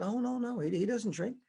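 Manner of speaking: wife denying to the pastor; lightly voiced wife. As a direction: brush it off sweetly. Wife answering.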